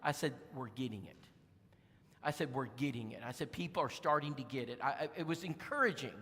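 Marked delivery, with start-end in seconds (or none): 0.6-1.2 hushed, much softer aside to his wife; soft secret whisper, like a happy secret; retold whisper. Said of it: "I whispered to my wife". 2.5-4.8 retold whisper. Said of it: "I whispered to my wife".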